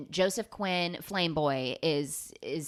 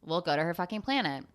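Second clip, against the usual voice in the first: bored. Retold or imitated. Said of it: "And they go".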